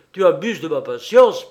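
loud, angry rebuke; furious shout. A narrator's reading. Enraged king rebuking Pierrot, furious shout. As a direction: thunder it at him. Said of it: "se mit dans une colère furieuse".

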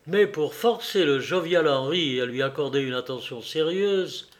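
ordinary delivery, nothing marked

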